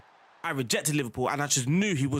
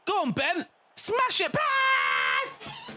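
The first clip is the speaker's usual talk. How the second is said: much higher, rising yell urging the player; excited shout; much slower than usual. Real, not acted. Gamer urging his player to shoot, high rising shout. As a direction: scream encouragement at the screen.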